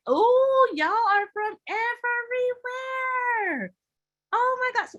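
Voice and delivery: high, drawn-out delighted squeal; real excited high-pitched gushing; thrilled amazement. Real, not acted. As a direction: marvel at the crowd, stretching the words out high. Thrilled at where viewers come from.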